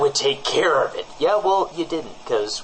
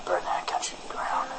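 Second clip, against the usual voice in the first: soft unvoiced whisper; a whispered threat under the breath; menacing whisper; 4 semitones lower, 7 dB quieter than usual. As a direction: hiss it as a sinister aside. Muttered aside against Canada.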